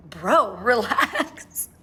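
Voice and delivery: long, drawn-out exasperated words with a laugh; mock exasperation. Reacting to him calling twice, amused exasperation. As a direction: stretch each word slowly, half laughing. Annoyed by his second call.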